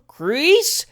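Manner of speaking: "crease" high and loud; "crease" pushed up hard; emphatic syllable. A narrator's reading. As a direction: hammer out "crease" as a coach demonstrating. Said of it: "emphasis on the last syllable".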